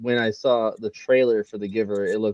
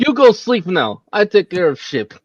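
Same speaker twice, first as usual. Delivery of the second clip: put-on Russian accent; 8 semitones higher, 8 dB louder than usual. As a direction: play Rocky with a big Russian accent. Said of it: "like, Russian accent in my head".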